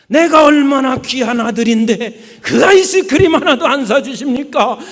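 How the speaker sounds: loud, high, laughing mock tantrum; child's yelling tantrum. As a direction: yell like a spoiled boy denied ice cream. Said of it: "그래서 내가 소리를 지릅니다".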